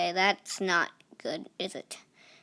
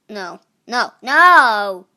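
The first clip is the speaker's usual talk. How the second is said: big anguished shout of no; full-voice panicked no; loud despairing no; 12 dB louder, much slower than usual. Real, not acted. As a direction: yell it out as the snake dies. About to be eaten in game.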